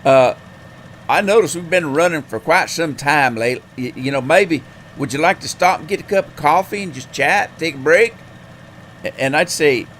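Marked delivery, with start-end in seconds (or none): 0.0-8.1 loud, high Southern "hey" easing into friendly chat; hammed-up Southern trucker on the CB; outrageous Southern accent. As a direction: invite a stranger over in a big Southern accent. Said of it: "this guy was talking like".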